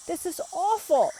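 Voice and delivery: dismayed. A narrator's reading. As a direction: complain over the noise. Pete covering ears at noise.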